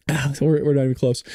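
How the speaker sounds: ordinary delivery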